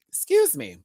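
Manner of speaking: with hiccups. Real, not acted. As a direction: talk through a sudden hiccup. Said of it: "I'm getting the hiccups".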